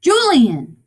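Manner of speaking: sharp, high, loud shout; calling out. Acted. Father searches the rooms for Julian, calling out loudly. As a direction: yell the name to summon him. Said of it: "he called".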